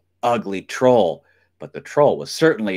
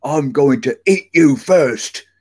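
loud, higher troll threat; menacing troll, raised voice; shouting troll; 4 semitones higher, 6 dB louder than usual. A narrator's reading. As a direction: threaten loudly to gobble them up. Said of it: "shouted the troll".

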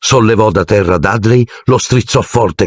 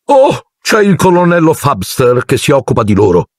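high, breezy aunt exclamation; high put-on aunt voice; Aunt Marge exclaiming; 7 semitones higher than usual. A narrator's reading. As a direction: toss it off high as a bossy aunt. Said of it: "esclamò zia Marge".

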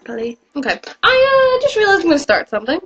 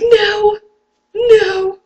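freaked out; 8 semitones higher, 4 dB louder than usual. Retold or imitated. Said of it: "I freaked out".

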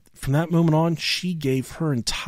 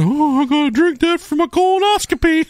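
much higher, louder mom voice; mom's voice; 5 dB louder than usual. Retold or imitated. Mom asking her son if he drank it, high and loud. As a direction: play the alarmed mother. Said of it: "She said".

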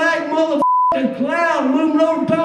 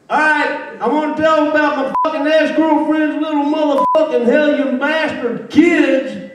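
ordinary talk, nothing marked

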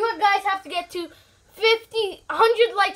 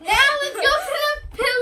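battle cry; 7 semitones higher, 4 dB louder than usual. Acted. Mock battle cry before pillow fight.

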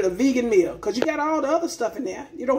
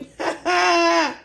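high burst of laughter; 7 semitones higher than usual. Real, not acted. Laughs at own joke.

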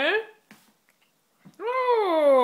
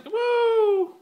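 high loud whoop; cheering; 6 semitones higher, 4 dB louder than usual. Real, not acted. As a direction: cheer him on. Cheering the cat on.

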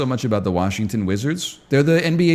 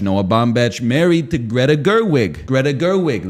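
usual speaking voice

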